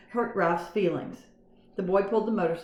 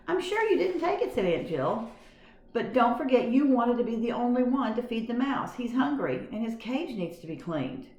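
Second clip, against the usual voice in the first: higher, gentle auntie voice; kind aunt; 6 semitones higher than usual. A narrator's reading. Aunt gently reminding the boy to feed his mouse.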